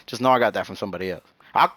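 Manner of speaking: ordinary talk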